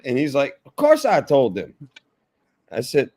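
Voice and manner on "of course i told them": high, emphatic Southern retort; Southern guy. Retold or imitated. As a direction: imitate the Southern customer, louder and higher. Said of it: "he was a Southern guy too".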